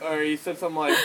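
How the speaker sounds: usual speaking voice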